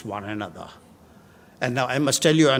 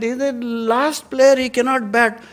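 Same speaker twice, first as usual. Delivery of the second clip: higher, insistent voice of an umpire; mildly acted protest, pitched up; 9 semitones higher, 4 dB louder than usual. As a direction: plead the batsman's case, raised pitch.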